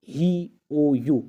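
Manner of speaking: drawn out letter names ہی، او یو; vowel letters spelled out slowly. Shown as a demonstration. Teacher to students, slowly spelling out vowel letters. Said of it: "واول میں نے کہا تھا اے آئی".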